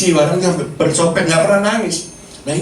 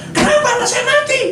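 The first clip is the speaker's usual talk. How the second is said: very high retold cry of protest; angry at God. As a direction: cry out the question at God, pitch soaring. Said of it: "Marah sama Tuhan saya".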